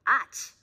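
half sneeze. A narrator's reading. Sneeze joke.

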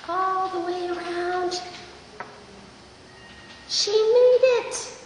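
slow exaggerated speech modeling. Shown as a demonstration. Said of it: "slow sing-song narration to child".

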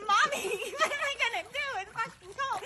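high, fast laughing squeal. Real, not acted. Laughing quote in cold water.

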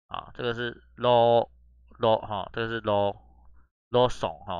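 "Lo-, Lawson" drawn out slowly and loudly syllable by syllable; slow sounding out. Shown as a demonstration.

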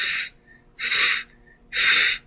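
"F" as a long voiceless hiss of air through lips and teeth; F sound. Shown as a demonstration. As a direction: breathe out "F" as a soft, unvoiced fff. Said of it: "Y suena F".

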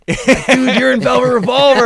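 high, loud, amazed; hissed excited shock to the drummer; stunned disbelief. Retold or imitated. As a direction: freak out at your bandmate mid-song.

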